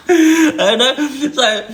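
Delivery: high words squeezed through laughter; laughing. Real, not acted. Storyteller trying to resume the tale, still laughing. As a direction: try to carry on while laughing.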